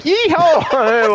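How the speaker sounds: high yeehaw; mock yeehaw. Imitating hometown folks.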